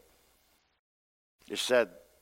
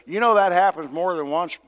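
normal talking voice

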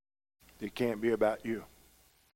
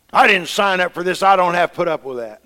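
loud, higher frustrated complaint; frustrated wife; 6 semitones higher, 12 dB louder than usual. Retold or imitated. Pastor's wife complaining to her husband, frustrated and loud. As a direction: mimic the flustered wife fed up.

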